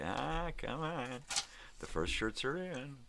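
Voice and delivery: slow high taunting sing-song; mock whine. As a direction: stretch it out like a teasing guilt trip. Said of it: "sending them pictures and say".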